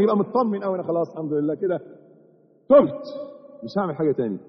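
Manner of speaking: ordinary talk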